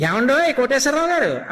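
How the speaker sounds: an angry mother's sarcastic respect to her naughty son; mock-formal angry mother. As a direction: scold him by calling him sir, icily polite. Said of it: "అమ్మకి చాలా కోపం వచ్చేసింది".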